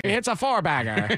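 lightly voiced old radio announcer; a hint of a vintage play-by-play call; old-time radio baseball voice. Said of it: "old-time radio baseball voice".

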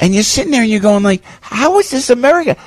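high, fast, incredulous; exasperated disbelief. Real, not acted. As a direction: throw up your hands in disbelief. Exasperated radio host venting.

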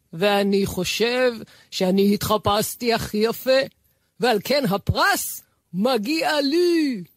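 high, drawn-out distant call; calling from afar. Acted. Fox calls back from a faraway hill, triumphant. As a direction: sing out the words slowly across a distance. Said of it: "נעצר וקרא אליהם".

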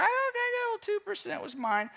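squeaky high mock concession; grudging admission. Preacher imitates someone admitting a little blame, squeaky high. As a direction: play a sulky person owning up.

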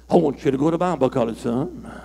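preacher lightly voicing the Lord to his son. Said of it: "the Lord says".

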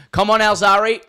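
put-on loud, high Aussie accent yell. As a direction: shout it like an Aussie fan in the stands. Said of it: "yelled out in the most Aussie accent".

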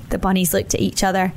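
ordinary delivery, nothing marked

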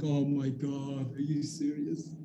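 very slow, soft disappointed voice; deflated dismay. Retold or imitated. Hearing they got the wrong box, slow and soft dismay. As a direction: let the letdown sink in slowly.

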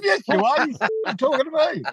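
high, cheeky retort; retold insistent comeback. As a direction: replay the needling with relish. Retold quarrel, teller insists back.

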